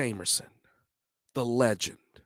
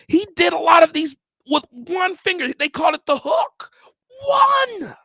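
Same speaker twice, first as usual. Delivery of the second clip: excited, high and loud; 9 dB louder than usual. Real, not acted. Bassist raving to viewers about the hook, excited and loud.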